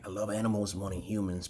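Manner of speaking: usual speaking voice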